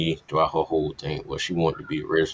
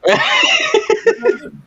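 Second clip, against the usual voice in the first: big high laugh; 8 dB louder than usual. Real, not acted. Host cracks up at joke.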